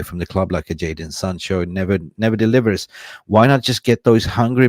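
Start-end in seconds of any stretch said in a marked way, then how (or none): none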